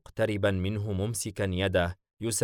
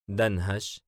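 ordinary delivery

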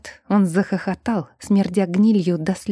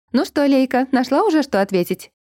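higher, perky calling voice; chirpy mother; 6 semitones higher, 3 dB louder than usual. A narrator's reading. Mother calling to daughter from the doorway, chirpy. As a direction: call out brightly to your daughter. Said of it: "Бодреньким голоском окликнула меня мама".

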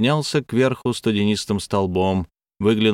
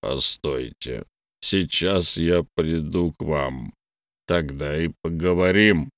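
low slow blob voice; blurted; 4 semitones lower, slower than usual. A narrator's reading. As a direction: blurt it, lightly voiced creature. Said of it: "выпалил Мюнстер".

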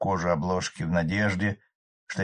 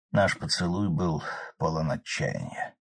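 plain reading voice

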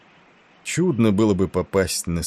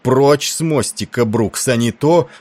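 loud, slow, high roar; 5 semitones higher, 7 dB louder than usual. A narrator's reading. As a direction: bellow the order, slowly. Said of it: "взревел Тиндал".

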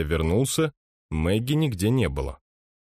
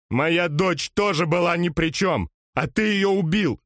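anguished yell; 10 semitones higher than usual. Acted. Grieving father yells accusation.

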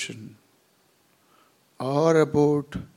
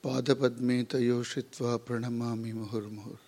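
soft, even chant with pauses between phrases; hushed, measured prayer recitation; Sanskrit prayer; 3 semitones lower, 6 dB quieter, much faster than usual. Real, not acted. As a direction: recite the invocation quietly and evenly. Opening Sanskrit prayer before the lecture.

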